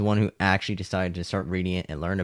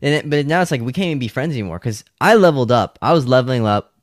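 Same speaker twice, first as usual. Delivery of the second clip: louder and higher, proud of leveling up; loud boast; 4 semitones higher, 8 dB louder, faster than usual. Real, not acted. Telling his old friends, a loud proud boast. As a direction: announce it, chest out.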